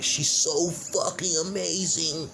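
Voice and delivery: slow, high sarcastic gushing; mocking gush. Mocking how the director props up the daughter, slow sarcastic gush. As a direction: drag it out in mock adoration.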